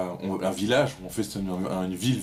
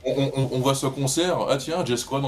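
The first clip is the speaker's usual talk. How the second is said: ordinary delivery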